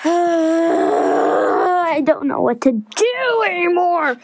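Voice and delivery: hammed-up slow wail with a sigh; mock despair. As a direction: overplay the kid's anguish at having nothing to do. Skit kid despairs over boredom.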